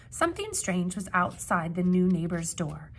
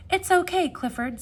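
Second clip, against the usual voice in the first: reassuring call; 10 semitones higher, 8 dB louder than usual. Acted. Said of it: "said Shun".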